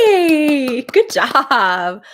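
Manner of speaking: loud, high, delighted yay; excited cheer. Real, not acted. Host cheering chat viewers on their progress, loud and delighted. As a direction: cheer them on with real joy.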